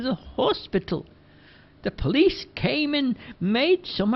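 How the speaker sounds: put-on high, slow gossiping old lady; gossiping Missus Dockery. Missus Dockery telling the lads the gossip, high slow old lady voice.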